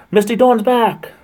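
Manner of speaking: high, loud, slow welcome; excited greeting. Real, not acted. Streamer greeting returning viewer Misty Dawn, loud and high. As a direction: call out happily to a returning friend.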